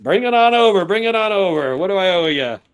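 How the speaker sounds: high, loud, jovial shopkeeper; hearty welcome. Acted. Orc owner receives the coffee barrel, heartily eager. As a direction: wave the delivery in eagerly.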